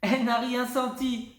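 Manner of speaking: high gleeful cry; a little girl crowing with delight; exclaiming. A narrator's reading. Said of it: "s'écrie Lulu Grenadine". (s'écrier, voix aiguë ravie)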